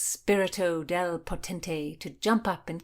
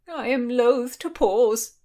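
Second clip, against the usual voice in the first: insisting; 7 semitones higher, 9 dB louder than usual. A narrator's reading. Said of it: "insisted the Marquis".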